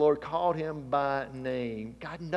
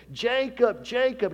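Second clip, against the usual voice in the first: calling out; 8 semitones higher, 4 dB louder, slower than usual. Acted. God calls Jacob.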